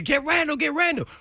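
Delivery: high mock yell of a name; retold yell. Yelling to the trainer across the field, high.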